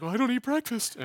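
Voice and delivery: high, put-on little protest; mock objector. Mimics an imagined listener's objection.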